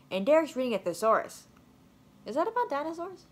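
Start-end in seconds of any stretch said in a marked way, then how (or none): 2.3-3.2 higher, wondering question; puzzled question. Acted. Kid wondering if thesaurus means dinosaurs, higher puzzled voice. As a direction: ask it innocently, pitch up.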